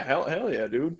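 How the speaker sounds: normal talking voice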